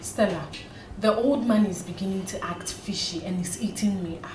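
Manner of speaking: hushed worried whisper, soft and breathless. Acted. Nancy whispers her fears about the old man to Stella. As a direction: whisper it so nobody in the house hears. Secret worried call to friend.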